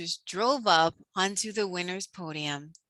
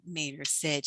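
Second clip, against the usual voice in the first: ordinary delivery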